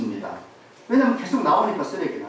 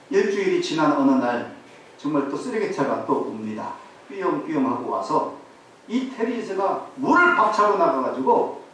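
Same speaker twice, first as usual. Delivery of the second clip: usual speaking voice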